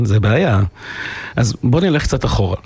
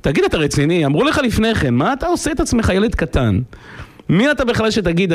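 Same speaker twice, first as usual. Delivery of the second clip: high-pitched, incredulous telling-off; exasperated scolding; 7 semitones higher than usual. Retold or imitated. Father rebukes son who ignored warnings, exasperated. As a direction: take the boaster to task, mock exasperation.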